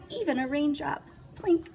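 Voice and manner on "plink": plink sound. Acted. Raindrop sound effect.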